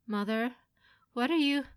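confused alarm. Acted. Son baffled by his mother's stance, alarmed confusion. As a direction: start unsure, climb into alarm.

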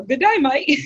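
accidental Australian while trying British; Australian accent. Retold or imitated. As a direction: chirp it like a fake Australian. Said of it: "it would come out more of a".

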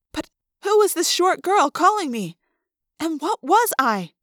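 ordinary delivery

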